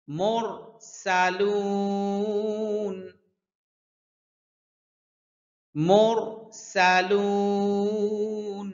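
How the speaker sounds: very slow মুরসালুন with a hissing স like frying fish; sizzling seen. Shown as a demonstration. Teacher showing the class the thin সিন sound. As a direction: let the স in মুরসালুন sizzle, drawn slowly. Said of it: "মাছ ভাজলে যেরকম আওয়াজ হয়".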